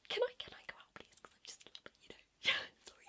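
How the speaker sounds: high, whispery stammered request; acted panicky pleading. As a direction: barely get the request out. Asks to leave while panicking.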